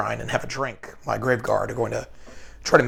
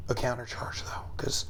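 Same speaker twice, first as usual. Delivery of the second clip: whispering; 13 dB quieter than usual. Real, not acted. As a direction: drop to a whisper mid-sentence. Whispered aside about plans.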